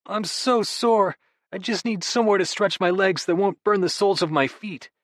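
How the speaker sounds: milk the soreness; hammed-up sore brother; whiny complaint. [name] whining about soreness.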